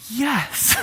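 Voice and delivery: a big, high triumphant yes. Retold or imitated. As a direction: cheer like you just won. Dad proud of daughter.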